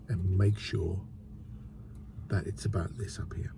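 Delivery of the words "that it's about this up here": whisper. Real, not acted. Vlogger whispering to viewers that it's all mental. Confiding point about the mind.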